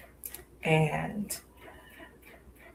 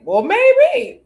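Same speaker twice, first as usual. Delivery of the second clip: hopeful maybe; 9 dB louder than usual. Retold or imitated. Seeing him out, hopeful.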